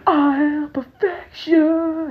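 high, slow, grand wrestler boast; wrestler catchphrase. Retold or imitated. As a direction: say it like a cocky wrestler making his entrance. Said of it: "I come out there".

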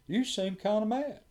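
mild mock of the leader's question; voicing the debrief leader. Leader remarks on his mood.

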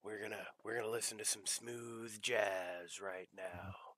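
smooth, soft DJ voice; late night radio host. Acted. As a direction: croon it like a midnight DJ. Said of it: "you could be, like, a late night radio host".

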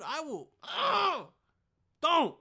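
high, loud strained yell; enraged outburst. Pretend. Vlogger losing it at the intruder, high loud yell. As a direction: explode at him.

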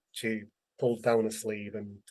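usual speaking voice

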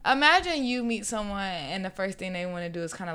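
normal talking voice

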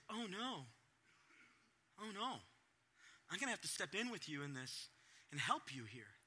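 soft, sorrowful oh no; a hint of a caring father; tender concern. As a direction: lean in with care. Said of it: "the heart of God is".

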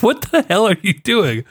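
a hint of a baffled wife; imitating his wife catching him at it; quoting his wife. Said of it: "And she's like".

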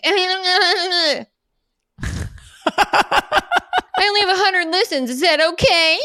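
high, small worried voice; timid mock newbie. Podcaster fretting to her group, high and timid. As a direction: ask it like an anxious beginner. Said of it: "posting on Facebook".

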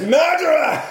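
high, loud accusing yell; hammed-up cop's accusation; accusing shout. Retelling the game, the cop shouts at the killer, hammed up. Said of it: "pulls a gun and says".

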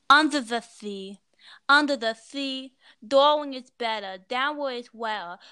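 sea said as thea; lisp. Pretend. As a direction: sing with a thick lisp. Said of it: "sing Under the Sea, but in a really strong lisp".

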